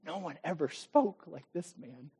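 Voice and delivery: preacher echoing soldiers, hushed awe; awed hush. Pretend. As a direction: let it land softly in wonder. Repeated awed quote.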